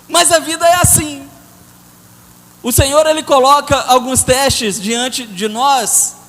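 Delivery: slow, loud preaching at full force. Acted. Pastor to the congregation about life's tests, slow loud preaching. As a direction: hammer the lesson home to the church, slowly and loud. Preacher drives home the lesson.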